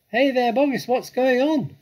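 very high, loud hail; calling out. A narrator's reading. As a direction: holler down to the farmers. Villagers calling down into the crater.

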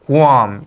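Guam said as "goo-AHM", falling; correct pronunciation of Guam. Shown as a demonstration. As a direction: model Guam with a clear gu-am glide. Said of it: "이렇게 읽으셔야지".

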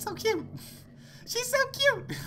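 very high, squeaky cooing; high-pitched fawning over something adorable; cutesy squeal. Real, not acted. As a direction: gush in a tiny high voice. Cooing over cute ghost girl.

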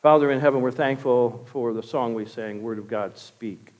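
low, softened praying voice; prayer. Real, not acted. Pastor thanks the Father and asks him to speak, praying.

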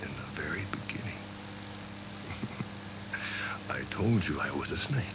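a cold, quiet threat; menacing snake whisper. Acted. Snake reminding the rabbit it warned him, hushed menace.

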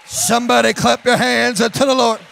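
loud revival shout with held last words; exultant shouted preaching. Real, not acted. Preacher stirring church as something comes alive, shouted preaching. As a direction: call the congregation to praise.

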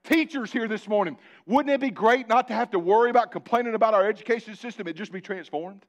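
loud, full-force preaching. Acted. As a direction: drive the point home from the pulpit. Preacher addressing teachers in congregation.

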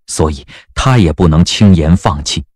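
normal talking voice

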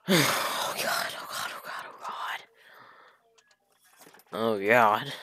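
soft, breathless whisper of dread. Real, not acted. Bracing for a scene while reading alone. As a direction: whisper it in horror.

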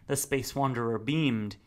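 usual speaking voice

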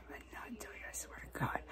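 hushed whisper to herself; whispered vow. Real, not acted. Vowing to herself not to pick the sore, whispered. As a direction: swear it under her breath.

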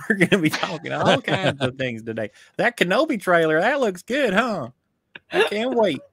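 friendly Southern reviewer, lilting up high; chirpy welcome in a put-on Southern accent. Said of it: "Do your Southern accent".

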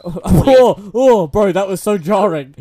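loud, high, amused disbelief; taken aback. Real, not acted. Jarred by friend switching accents, loud surprise. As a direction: react as if jolted by the switch.

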